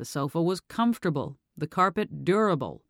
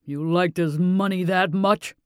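slow, louder angry father's question; sudden anger; 2 semitones higher, 6 dB louder than usual. A narrator's reading. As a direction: let the father's anger break through. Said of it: "the sudden anger in his voice".

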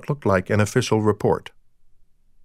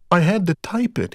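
a touch of wounded pride, pitched higher; slightly hurt; 7 semitones higher than usual. Acted. Deke answering Deb's scolding, slightly hurt. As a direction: defend yourself, a little stung. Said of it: "sounding slightly hurt".

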